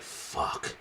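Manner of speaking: silent mouthed swear, barely a breath; mouthed curse. Retold or imitated. Liv mouthing a curse as Jade arrives, voiceless. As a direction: mouth it without voice, caught out. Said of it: "you can even see Liv mouth".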